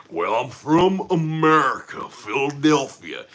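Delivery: slow, drawn-out, hammed-up American accent; put-on American drawl; mock American accent. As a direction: lay the American accent on thick and take it slowly. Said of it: "the most American accent".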